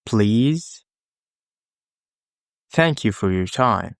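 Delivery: ordinary delivery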